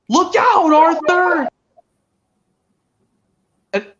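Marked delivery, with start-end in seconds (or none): 0.1-1.5 high, loud mock scream of warning; Percy. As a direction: scream a warning as a cartoon engine. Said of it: "Percy screams out".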